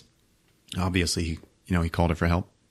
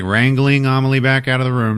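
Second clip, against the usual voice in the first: ordinary talk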